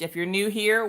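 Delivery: ordinary talk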